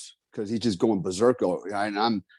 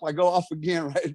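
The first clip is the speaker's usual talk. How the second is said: usual speaking voice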